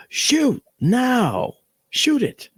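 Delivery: high urgent shout; man yelling an order to fire; retold yelled order. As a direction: bark the order to shoot, high. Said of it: "he's yelling at me".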